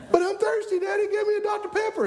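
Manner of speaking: put-on high, insistent child begging Daddy; pleading kid. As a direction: plead like a thirsty kid in the back seat. Acts himself as pleading child.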